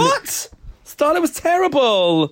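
high-pitched, drawn-out incredulous cry; scandalised, stretching the words out high; outraged disbelief. Real, not acted. Mock outrage at co-host's pick.

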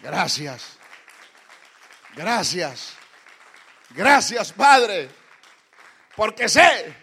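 loud, proclaimed thanks; loud thanksgiving. Acted. Preacher repeating Jesus's prayer to the church, loud. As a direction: cry out the thanks.

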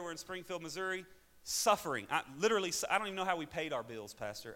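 usual speaking voice

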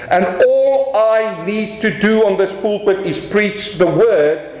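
excited pulpit proclamation; fervent preaching. Acted. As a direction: lift it with joy for the church. Rising preaching.

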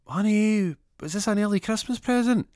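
high, loud mock housewife; excited woman's voice. Wife calling husband about a surprise parcel, high put-on woman's voice. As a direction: call it out high, holding the notes. Woman finding a delivered package.

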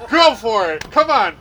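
imitated challenge. Wrestler stands up to challenge the fan, loud goading. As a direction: goad them like a cocky heel.

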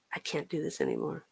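very soft, inward voice; quiet resolve. Retold or imitated. Woman recalling her breaking point on a snowy street, hushed and inward. Quiet realization retold to herself.

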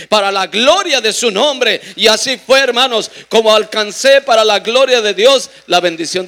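loud, high, slowed-down pulpit proclamation; full-force preaching. Acted. Preacher proclaims the baptism of fire to the brothers, loud and high.

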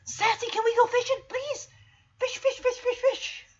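high, eager begging voice; Chance. Acted. Dog begging the cat to go fishing, high and excited. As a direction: plead excitedly like an eager pup. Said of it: "Chance's like".

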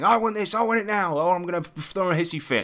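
high-pitched imitation of a bratty child; mock a kid demanding things. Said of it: "very demanding".

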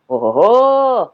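slow, drawn-out ого-го; mock bear exclamation. Bear marvels at the rabbit's question, slow exclaim. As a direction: stretch it like a big impressed bear. Вигукнув ведмідь.

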